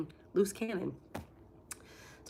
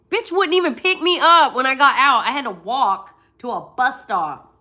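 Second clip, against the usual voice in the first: louder, higher angry rant; 6 semitones higher, 12 dB louder than usual. Real, not acted. Vlogger ranting to viewers about sister, loud angry. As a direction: vent the grudge hard.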